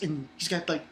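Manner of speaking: ordinary delivery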